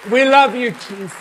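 high, loud praise shout; shouted worship. Acted. Pastor rousing the congregation to worship, loud and high. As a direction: lift the whole room with a full-voiced cry.

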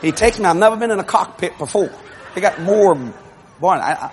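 normal talking voice

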